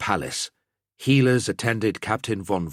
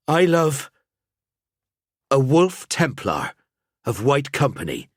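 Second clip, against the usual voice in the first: a maid answering her wounded lover, emphatic; emphatic declaration; 7 semitones higher, 3 dB louder than usual. Acted. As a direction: declare it firmly, word by word. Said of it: "she declared emphatically".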